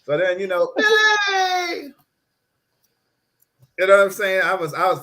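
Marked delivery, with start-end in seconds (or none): 0.8-1.9 high, loud, long "hey"; drawn-out shout. Acted. Kid showing off to friends outside, high drawn-out holler.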